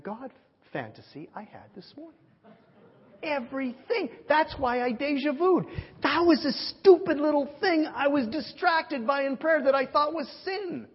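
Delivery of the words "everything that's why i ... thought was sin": high, loud exclamation; worked-up preacher, high and forceful; astounded realization. Retold or imitated. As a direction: cry out as it all dawns on you. Preacher exclaims his sudden realization.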